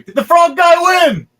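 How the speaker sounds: high, loud, eager question; king demanding to know if the frog won, high and loud. Acted. The king asks who won.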